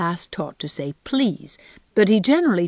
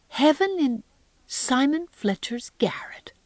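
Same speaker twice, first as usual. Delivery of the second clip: high, breath-caught disbelief; amazed gasp; 7 semitones higher than usual. A narrator's reading. Anne reacts to heaven in a garret, gasping amazed. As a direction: gasp it slowly, broken by astonishment. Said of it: "gasped Anne, too amazed even to laugh".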